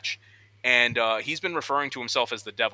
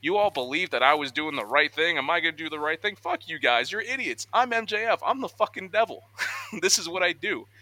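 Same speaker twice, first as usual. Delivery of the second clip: high mock rant at the crowd, sneering; imitating a ranting heel; 6 semitones higher than usual. Wrestler mocking reporters in backstage scrum, high sneering rant. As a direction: play the gloating villain mocking everyone. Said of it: "He goes off and he's like".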